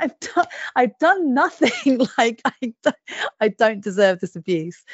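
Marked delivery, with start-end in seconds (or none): 0.0-3.0 high-pitched, drawn-out protest of innocence; retold indignant protest. Mum retelling her protest to teasing child, indignant. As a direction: plead innocence, slow and pitched way up.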